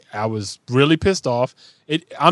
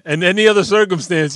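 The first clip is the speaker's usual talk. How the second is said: ordinary talk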